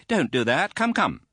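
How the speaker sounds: put-on high, hasty tut-tut from a stern old lady; hurried tutting. A narrator's reading. Aunt hastily hushing the crying widow, high and brisk. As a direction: brush the tears away briskly. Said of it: "said Miss Betsy in a hurry".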